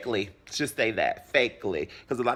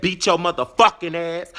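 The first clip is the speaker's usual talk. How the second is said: loud, pitched-up fighting words; retold angry threat; 4 semitones higher, 7 dB louder than usual. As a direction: act out a punched threat. Acting out her threat.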